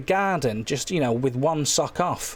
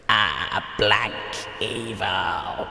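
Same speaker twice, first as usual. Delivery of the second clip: deep, slow ominous jingle voice; 7 semitones lower, much slower than usual. Acted. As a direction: drop the voice low and menacing for the jingle. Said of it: "and here's the jingle".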